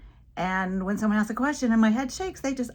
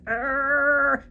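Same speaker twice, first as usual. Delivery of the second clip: loud, higher drawn-out "arr"; imitated straining noise; 7 semitones higher, 7 dB louder than usual. Little boy shows teacher he can shake too, straining noise. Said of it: "he went like this".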